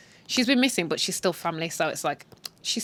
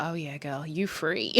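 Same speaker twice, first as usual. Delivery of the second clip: low, soft murmur of approval; hushed, conspiratorial girlfriend talk; knowing aside; 5 semitones lower, 9 dB quieter than usual. Retold or imitated. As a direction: quietly cheer her on, low.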